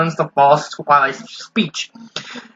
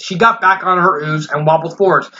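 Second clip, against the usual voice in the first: plain reading voice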